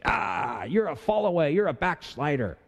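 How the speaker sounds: high-pitched gasp of alarm, then accusing; mock horrified accusation. Cult member to someone leaving for another church, mock horror. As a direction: act out an overdramatic, scandalized reproach.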